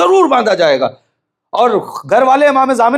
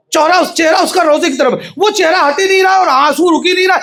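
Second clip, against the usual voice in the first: full-force preaching; 9 semitones higher than usual. Acted. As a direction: cry it out to the hall.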